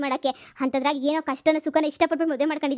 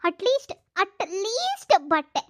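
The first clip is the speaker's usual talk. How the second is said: very slow, stretched-out whining "at least"; whiny drawl; 4 semitones higher, much slower than usual. Acted. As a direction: drag it out like a sulking plea. Wife whines defending her shopping.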